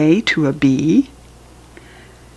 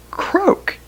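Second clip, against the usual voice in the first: frog croak; 14 semitones higher than usual. Pretend. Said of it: "This is the frog".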